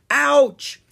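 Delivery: high, loud yelp of pain. Acted. Owen trips over a headstone, yelping in pain. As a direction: cry out as if just hurt.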